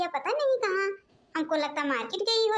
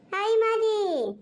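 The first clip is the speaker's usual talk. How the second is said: drawn-out call back; 6 semitones higher, 4 dB louder, much slower than usual. Acted. Bahu answering the call.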